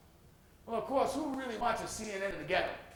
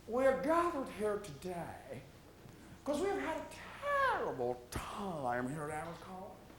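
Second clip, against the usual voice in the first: stiff dean; 4 semitones lower, 9 dB quieter than usual. Acted. Dean addressing students at campus rally, stiff and soft. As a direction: play the awkward college dean at the mic.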